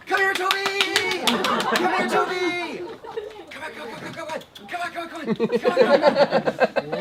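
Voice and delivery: high, loud coaxing call; squeaky calling a dog. Real, not acted. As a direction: coax the dog over, way up high. Calling the dog ring bearer.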